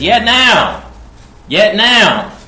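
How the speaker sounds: high, loud proclaiming cry; ringing call. Acted. Preacher crying the prophet's words to the people, loud and high. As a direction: call it out to the whole congregation.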